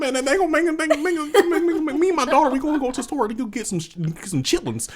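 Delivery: high rambling Southern mumble; high Boomhauer mumble. Acted. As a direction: garble it like a high-pitched Boomhauer. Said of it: "It's like Boomhauer, but it's higher pitched".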